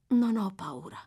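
plain reading voice

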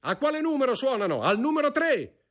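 urgent, very high-pitched shout; brigadier shouting. A narrator's reading. Brigadier demanding which room's bell rings, shouting. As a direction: call out the question and answer, pitched high. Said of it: "gridò il brigadiere".

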